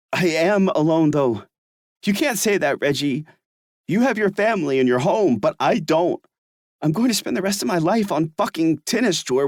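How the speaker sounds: bitter outburst. Acted. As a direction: throw it back at him, hurt and alone. Everett's bitter outburst, higher voice.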